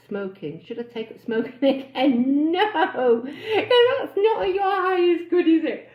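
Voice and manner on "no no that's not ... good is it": louder and higher, half laughing, slow; laughing mock scolding. Real, not acted. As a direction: tell her off while cracking up. Answering member's joke about smoking.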